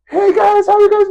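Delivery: very high-pitched mock YouTuber greeting; YouTuber impression. Imitating a YouTuber greeting viewers, exaggerated high voice. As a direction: squeal it like an over-the-top vlogger. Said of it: "This is my impression of a YouTuber".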